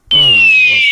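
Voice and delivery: shrill high hawk cry imitation; piercing falcon call; hawk screech. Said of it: "you know that sound".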